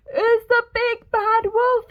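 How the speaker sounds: very high, loud piglet panic; frightened cry. Acted. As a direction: cry out in terror. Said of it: "he cried".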